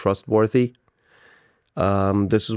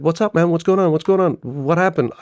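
higher-pitched, urgent worried questions; retold alarm; 6 semitones higher than usual. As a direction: press the caller anxiously for news. Retold alarm at crying call.

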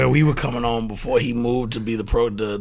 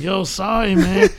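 sheepish sorry; 10 semitones higher, 6 dB louder, slower than usual. Retold or imitated. Roommates apologizing sheepishly.

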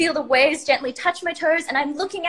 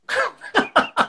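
sudden high, loud burst of laughter; 10 semitones higher, 5 dB louder than usual. Real, not acted. Storyteller cracking up as the imagined bird drops dead, loud laugh. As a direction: crack up at the absurdity.

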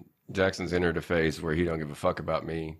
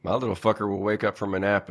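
ordinary delivery

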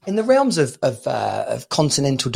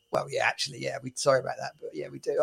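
much quieter, lower sheepish mumble; sheepish admission; 3 semitones lower, 14 dB quieter, faster than usual. Acted. Acting out people admitting they nick stuff, sheepish. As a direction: own up softly, as if reluctant.

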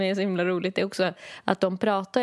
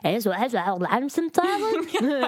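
a lightly voiced baffled operator; confused Danish operator; 4 semitones higher than usual. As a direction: mimic a puzzled emergency operator. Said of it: "att de bara".

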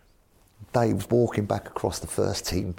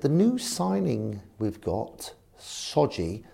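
put-on slow, higher posh accent; Eddie Rogers; 4 semitones higher, much slower than usual. Chairman raises the new signing, mock posh slow voice. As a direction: spell it out slowly in a put-on posh voice. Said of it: "'cause very posh, Eddie".

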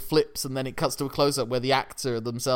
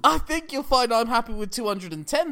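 posh polite bidder; 10 semitones higher than usual. Pretend.

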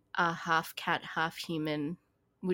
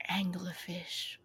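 whisper; 9 dB quieter, slower than usual. Real, not acted. Repeats word in a whisper.

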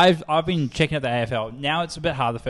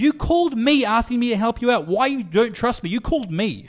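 higher and louder, incredulous and fed up; exasperated retort; 9 semitones higher, 4 dB louder than usual. Retold or imitated. Retelling a customer call, exasperated and incredulous. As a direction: insist they rang you first, losing patience. Frustrated client call retold.

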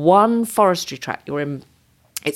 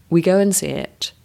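ordinary delivery

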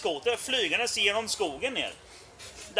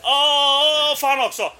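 retold exasperation; 5 semitones higher, 7 dB louder, slower than usual. Acts out reply to Martin.

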